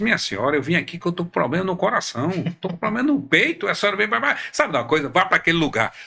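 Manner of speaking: losing patience. Retold or imitated. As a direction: replay telling off the woman, voice rising. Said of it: "Aí eu levantei e perdi a paciência".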